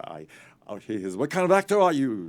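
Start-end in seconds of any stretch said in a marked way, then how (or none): 1.2-2.3 much higher and louder challenge; demanding shout. Retold or imitated. Preminger grilling the young actor, loud demand. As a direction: fire the question at him.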